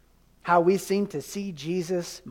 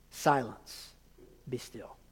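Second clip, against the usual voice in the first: low, much softer, calm rebuke; quiet command; 7 semitones lower, 12 dB quieter, slower than usual. Retold or imitated. Jesus speaking to the sea, low and quietly commanding. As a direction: hush the sea with quiet authority.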